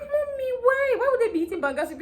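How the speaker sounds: high, whining complaint, fast at the end; whiny protest. Retold or imitated. Daughter protests to mom about banga soup, high whine. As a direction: squeal it like a spoiled kid.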